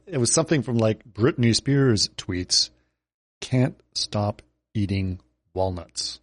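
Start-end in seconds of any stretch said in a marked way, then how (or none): 3.4-6.2 slow, lower, softer deadpan; slow low deadpan read; Nick Offerman deadpan. Retold or imitated. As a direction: read the tweet dead serious. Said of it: "in this Nick Offerman way".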